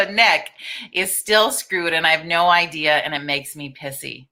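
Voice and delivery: ordinary delivery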